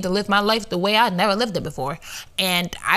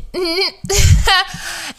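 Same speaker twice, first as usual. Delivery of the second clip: sudden loud high cackle; loud high laugh; 11 semitones higher, 6 dB louder than usual. Real, not acted. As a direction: burst out laughing loudly. Laugh after admission.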